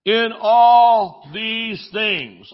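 slow loud preacher emphasis; very slow emphatic. Shown as a demonstration. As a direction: stretch In all these things, loud. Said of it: "drawn-out preaching emphasis".

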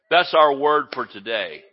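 ordinary delivery

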